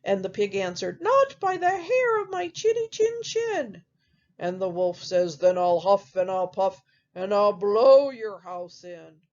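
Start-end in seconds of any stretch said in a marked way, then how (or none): 1.0-3.8 high pig voice, defiant; the pig refusing the wolf, pitched way up. Acted. 5.4-9.2 big bad wolf. Wolf threatens the pigs.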